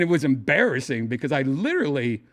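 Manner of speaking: ordinary talk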